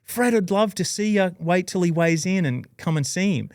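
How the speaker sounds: light lady's voice, keen to bring him over; friendly woman. Retold or imitated. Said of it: "And she's like".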